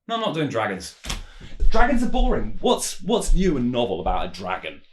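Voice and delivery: loud, high outburst of refusal; exasperated rant. Acted. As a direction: blow up in protest, pitch jumping. Skit, refusing an off-screen demand.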